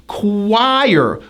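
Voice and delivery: choir as kuh-WIRE; choir, slow with a strong W; strong hidden W. Shown as a demonstration. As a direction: push the W in choir. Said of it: "with a strong hidden W sound".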